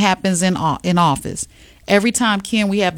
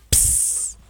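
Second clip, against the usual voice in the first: a quick hissed psst; whispered call sound, like signaling a friend; 8 dB quieter than usual. Pretend. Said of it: "you know what our call sound would be?".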